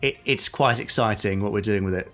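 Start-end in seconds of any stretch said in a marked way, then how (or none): none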